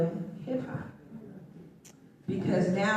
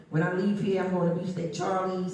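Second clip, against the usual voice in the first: ordinary talk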